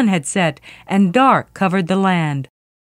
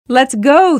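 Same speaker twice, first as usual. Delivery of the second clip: higher, louder rallying shout; brisk storybook shout; 5 semitones higher, 6 dB louder than usual. A narrator's reading. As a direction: call out the order to set off. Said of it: "Thor shouted".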